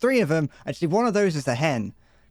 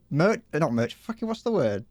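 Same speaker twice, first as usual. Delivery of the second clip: usual speaking voice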